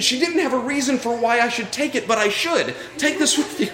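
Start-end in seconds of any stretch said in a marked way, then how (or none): 3.0-3.7 high-pitched imitation of an insistent mother; lightly voiced mom. Mom hands son a tiny casserole, high insistent imitation.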